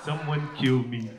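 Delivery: hammed-up hopeless husband; defeated husband. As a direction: sigh it out like a broken man. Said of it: "he just goes".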